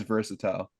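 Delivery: versatile with a full "tile" ending; British pronunciation. Shown as a demonstration. Host owning up to his habit, British pronunciation of versatile. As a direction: end versatile like floor tile. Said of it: "That's how they pronounce it in the U K".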